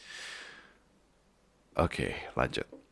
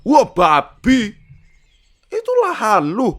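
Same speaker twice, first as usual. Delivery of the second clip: much higher, loud and slow marvelling; amazed Lipin; 10 dB louder, much slower than usual. Acted. Lipin amazed at Marco's land. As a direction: gawp at the big plot. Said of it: "Lipin amazed at land".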